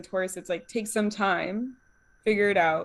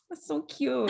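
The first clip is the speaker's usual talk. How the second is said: higher-pitched, far-from-usual cutesy coo; mock cooing; 5 semitones higher, 4 dB quieter, slower than usual. People cooing at a short speaker's tantrum, mock cooing. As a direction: coo it as if at someone tiny.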